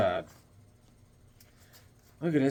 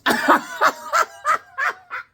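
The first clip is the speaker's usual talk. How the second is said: high staccato cackle; long high laugh trailing off into gasps. Real, not acted. Laughing at own Loki Charms joke, high cackle.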